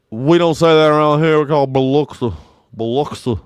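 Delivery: loud, high Mississippi local, "Biloxi" as buh-LUK-suh; hammed-up Mississippi accent, slurred "Biloxi"; slack-jawed Mississippi accent. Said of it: "they got that slack-jawed accent".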